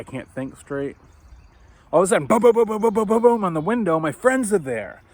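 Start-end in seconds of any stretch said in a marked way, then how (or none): 1.9-4.0 loud, high boom boom knocking; excited mock knocking on a window; imitated banging. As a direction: pound it out like fists on glass.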